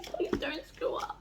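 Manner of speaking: soft whispered begging; whispered plea. Real, not acted. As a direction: whisper it anxiously.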